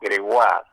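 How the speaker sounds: Gregoire said French, grewal, stress on the A. Shown as a demonstration. Correcting how his surname is said, Gregoire in French. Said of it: "Con acento en la A".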